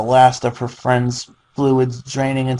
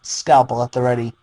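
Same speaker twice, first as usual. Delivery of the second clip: ordinary delivery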